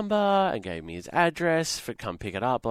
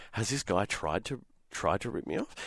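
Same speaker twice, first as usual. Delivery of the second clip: quiet suspicion; 9 dB quieter than usual. Retold or imitated. Retold private doubt about seller.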